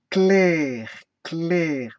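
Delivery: "Clair" loud, held in one syllable; single-syllable demo. Shown as a demonstration. Teacher proving one syllable, "clair" loud and sustained. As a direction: stretch "clair" out in one piece. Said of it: "il n'y a qu'une seule syllabe".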